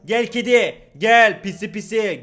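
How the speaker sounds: loud, drawn-out call to the cat. Acted. Player calling the game cat, loud and drawn out. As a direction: coax the kitty over loudly.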